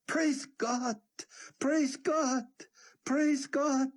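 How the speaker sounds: high, ringing praise; joyful shouting. A narrator's reading. Yaakov shouting praise with joy, high. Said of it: "laughed and cried and shouted".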